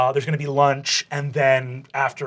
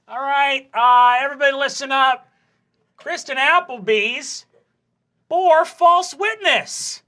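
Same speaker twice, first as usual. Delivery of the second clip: staged public telling-off; 12 semitones higher, 5 dB louder than usual. Acted. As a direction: make a big scene calling out the culprit. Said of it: "I want you to yell right now and make a really big scene".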